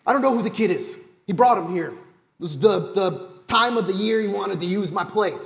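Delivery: high, loud, frantic protest; panicked pleading NPC. Acted. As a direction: blurt it out in terror, voice jumping high. NPC terrified, interrogated.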